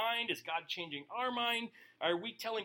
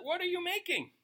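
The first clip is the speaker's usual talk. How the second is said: much higher, incredulous question; high mock protest; 11 semitones higher than usual. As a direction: squeal it like indignant clay. Clay questioning its maker.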